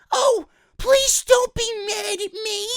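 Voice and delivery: mock her in a squeaky pleading voice; mocking Ronda. Vlogger mocking Ronda begging Sasha in a high whiny voice. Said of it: "Ronda Rousey's over here".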